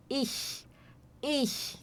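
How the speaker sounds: "Ich" hushed, ending in a soft hissing "ch" from the palate; ich sound. Shown as a demonstration. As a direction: breathe out "ich" with the soft German ch. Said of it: "este som que nós precisamos fazer".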